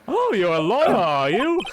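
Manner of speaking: lightly voiced quizzical old man; character voice. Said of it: "I like the way you do it".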